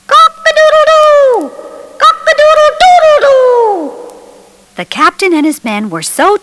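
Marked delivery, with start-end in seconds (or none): 0.1-3.9 rooster crow. A narrator's reading. Peter startles the pirates from the cabin, loud high rooster crow. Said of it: "it was Peter who had cried out".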